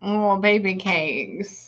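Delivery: slow, soft and tender; tender comfort. Real, not acted. Medium comforting the grieving sister, slow and tender.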